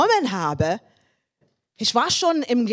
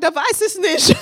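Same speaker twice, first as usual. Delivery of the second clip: high, laughing reply; laughing aunt; 7 semitones higher, 5 dB louder than usual. Retold or imitated. Aunt answering the mother through laughter. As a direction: laugh it out, unsure. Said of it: "sie sagt".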